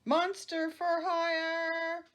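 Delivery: dramatic title read. A narrator's reading.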